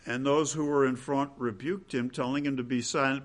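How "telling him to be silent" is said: usual speaking voice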